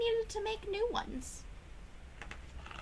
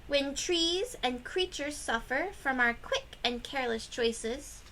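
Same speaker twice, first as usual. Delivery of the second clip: plain reading voice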